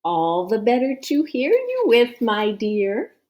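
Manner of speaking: menacing sweetness; wolf as grandma. Acted.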